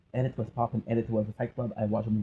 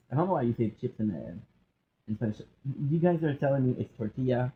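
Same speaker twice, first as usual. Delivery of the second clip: normal talking voice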